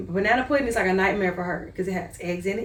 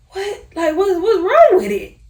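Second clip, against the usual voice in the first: high, loud mock-appalled questioning; disgusted disbelief; 9 semitones higher, 8 dB louder than usual. Mother reacts to milk after two weeks without, appalled. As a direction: act out recoiling from something nasty, pitched way up. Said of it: "it was so nasty to me".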